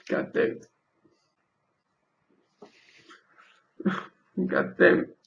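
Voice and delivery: breathy, sighing curses through tears; whispered swearing, then tearful words; real crying, half whispered. Real, not acted. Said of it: "I hate crying".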